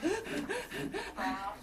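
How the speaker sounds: big noisy gasp for air; loud gasp. Shown as a demonstration. Teacher shows kids the wrong loud breath, gasping. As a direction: gasp in loudly like a bad breath. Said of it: "We don't go".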